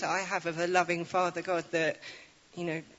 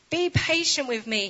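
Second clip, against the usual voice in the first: begging; 6 semitones higher, 4 dB louder than usual. Acted. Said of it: "he begged".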